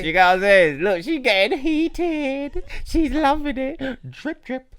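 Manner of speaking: high, slow laughing tease. Real, not acted. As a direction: drag it out between giggles. Laughing through love doctor joke.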